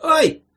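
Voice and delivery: high, loud ouch; startled cry of pain; pained yelp. A narrator's reading. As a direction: yelp sharply at a poke. Patient poked in the liver.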